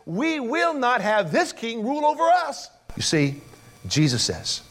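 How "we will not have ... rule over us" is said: defiant crowd. Acted. Acted quote of the crowd.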